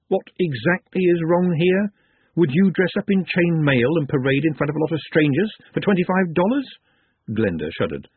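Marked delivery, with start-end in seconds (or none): 0.1-6.8 higher, challenging inner voice; talking sherry. Acted. The sherry challenging Glenda, lightly voiced and goading. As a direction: goad with it, pitched up and climbing at the end.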